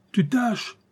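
ferocious high-pitched retort; fierce cry. A narrator's reading. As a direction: throw it back at him fiercely, without extra volume. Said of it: "cria le tyran férocement".

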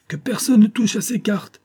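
high, loud order to everyone; commanding cry. A narrator's reading. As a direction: cry out a sharp prohibition. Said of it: "s'écria le duc".

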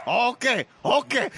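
high-pitched punchy movie-gangster impression; Al Pacino. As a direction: exclaim it as a hammed-up film star. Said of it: "Think Al Pacino".

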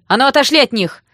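loud, high-pitched order; piercing yell. A narrator's reading. Sister rushes to the rescue, yelling piercingly. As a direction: scream them away from your friends. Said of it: "раздался пронзительный вопль".

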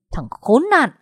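high outburst of rage; furious curse. Acted. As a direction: curse him as you strike. Enraged man strikes Lưu. (chửi giận dữ)

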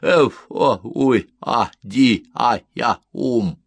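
punchy, nonsensical grunts of a disturbed sleeper; sleepy mumbling. A narrator's reading. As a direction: babble syllables like someone half-awake. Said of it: "промямлил сонный жилец".

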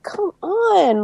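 exasperated protest. Retold or imitated. Viewer fed up with mopey Ned, exasperated. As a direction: plead as if fed up with him.

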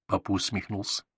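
ordinary delivery, nothing marked

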